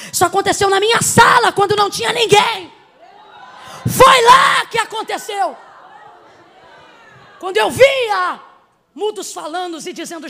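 loud, driving preacher's cry; fervent revival preaching, loud and rising; full-force preaching. Acted. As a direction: preach it out to the whole hall, building.

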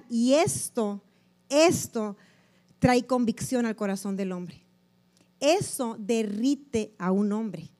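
ordinary talk, nothing marked

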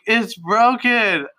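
high, drawn-out whimper; mock tearful wail. Drunk client showing the tattooist her finger, mock tearful whine. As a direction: imitate a crying client, slow and high-pitched. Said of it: "she just keeps crying".

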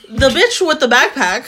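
ordinary talk

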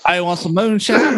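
high, hammed-up mock Texas accent. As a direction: join in with a squeezed-up Texas impression. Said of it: "do your Texas accent one more time".

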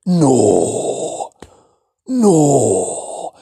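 loud, drawn-out no and slow non ci credo; disbelieving no. Shown as a demonstration. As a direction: refuse to believe your eyes. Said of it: "disbelief demo".

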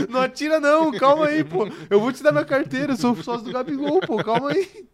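high, loud, pleading begging voice; panicked robbery victim. Retold or imitated. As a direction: beg the robber not to shoot, high and loud. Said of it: "Tipo assim, o Marcos".

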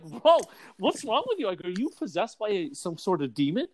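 high-pitched baffled reaction; high, incredulous retort; mock alarm. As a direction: act out being taken aback, voice jumping up. Retelling shocked reaction to priest.

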